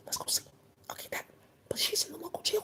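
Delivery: hushed conspiratorial whisper. Acted. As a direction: lean in and whisper the secret. Secretive whispered aside.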